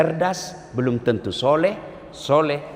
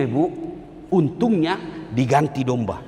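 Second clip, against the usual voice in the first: normal talking voice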